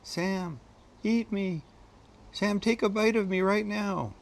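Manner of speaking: high, coaxing little voice of the dish; be the food begging to be eaten; playful high voice of the steak; food's voice. Acted. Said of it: "it saying".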